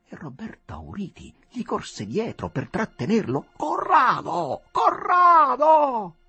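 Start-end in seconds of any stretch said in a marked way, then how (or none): 3.6-6.2 high, loud, drawn-out shout; richiamo gridato. A narrator's reading. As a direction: call urgently after someone running away, stretching the name. Lo rincorre chiamandolo. (grido acuto e prolungato)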